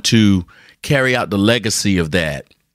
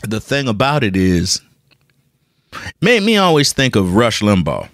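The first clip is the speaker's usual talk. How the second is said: ordinary delivery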